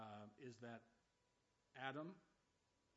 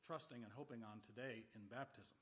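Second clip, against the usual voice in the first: ordinary talk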